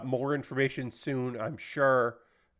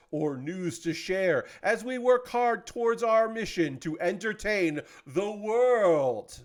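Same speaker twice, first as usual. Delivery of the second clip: higher, slow, grand stage-magician flourish on "or news to share as we work hard towards our mission to entertain the world"; 5 semitones higher than usual. Pretend. YouTuber closing the statement like a magician, slow and grand. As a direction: deliver "to entertain the world" slowly, like the big finish of a magic trick. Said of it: "in my best magician voice".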